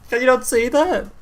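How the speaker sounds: high-pitched, loud laughing protest; laughing disbelief. Real, not acted. Player insists the card is Mario's turtle, incredulous and laughing. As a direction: protest through real laughter.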